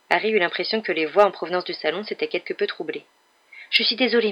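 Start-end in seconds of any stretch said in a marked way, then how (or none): none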